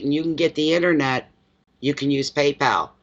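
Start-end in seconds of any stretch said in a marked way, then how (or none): none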